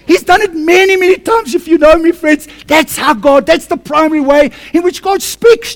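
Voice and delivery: loud, high, surging preacher's cry; preaching at full force. Acted. Pastor lifting the church with a loud, high preaching cry. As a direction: let the testimony burst out like a revival.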